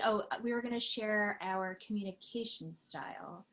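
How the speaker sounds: normal talking voice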